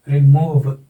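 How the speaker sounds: Remove with a light रि and strong मूव; stress on second syllable. Shown as a demonstration. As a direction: hit मूव in remove, keep रि short. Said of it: "second syllable पर word accent है".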